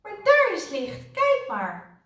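loud, high cry of discovery; loud, startled hope; excited call. A narrator's reading. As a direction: call out the sudden good news. Sudden sighting of light, calling out.

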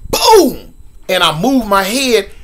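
usual speaking voice

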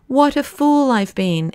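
high-pitched self-reproaching exclamation; exclaiming. A narrator's reading. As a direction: exclaim it in sudden realisation. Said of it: "exclaimed the colonel".

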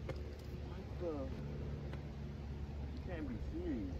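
breathy whisper of disbelief; a stunned hushed whisper. Real, not acted. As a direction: mutter it under your breath, stunned. Alone, spots pirate ship.

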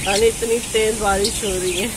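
usual speaking voice

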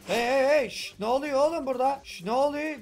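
a bully storming in to interrupt; loud calling out. Acted. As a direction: call out loud to break them up.

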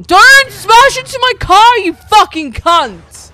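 high, loud enraged yell; furious shout. Real, not acted. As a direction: scream at the driver who hit you. Car crashed in game.

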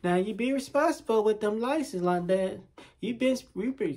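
mock her high little admonishing voice; mimicked lecturing woman.

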